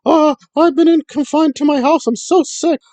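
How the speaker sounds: hammed-up sickly whimper, high pitched; whining and crying. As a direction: whine it like a fake invalid begging. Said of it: "she's whining and crying".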